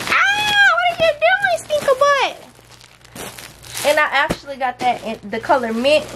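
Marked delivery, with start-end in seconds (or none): 0.1-2.3 playful shout. Real, not acted. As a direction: call out to the little one teasingly. Mom calling out to toddler.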